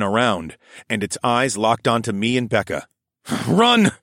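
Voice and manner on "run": loud urgent yell; shouting. A narrator's reading. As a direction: bark the order to flee. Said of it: "I said".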